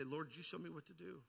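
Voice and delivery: ordinary delivery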